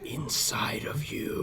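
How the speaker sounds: sinister breathy whisper; hammed-up menacing whisper; creepy reveal. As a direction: whisper it like a villain's gloat. Villain's reveal in skit.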